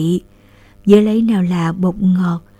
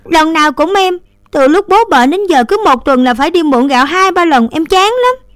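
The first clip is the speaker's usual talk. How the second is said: higher, louder fed-up complaint; grumbling; 8 semitones higher, 6 dB louder than usual. A narrator's reading. Sister complaining about always borrowing rice, grumbling. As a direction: grumble as you stomp off. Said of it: "vừa đi vừa ngùng ngoằng".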